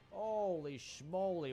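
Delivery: slow drawn-out exclamation. Real, not acted. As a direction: stretch out holy schmoly slowly.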